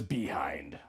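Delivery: breathy whispered "behind."; whispered aside. Pretend. Reader sneaking in the word "behind." as a whispered joke.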